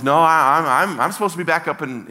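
loud, high, stumbling protest; insistent refusal. Retold or imitated. TJ turning down the church, insistent refusal. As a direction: stammer out an emphatic no.

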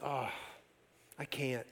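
soft, low voice for a retold reply; reluctant admission. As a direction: quietly imitate a reluctant admission. Retold woman's reluctant confession.

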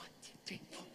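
hushed counting under the breath; whispered counting. Retold or imitated. Daughter counts out the paper game, imitated whisper.

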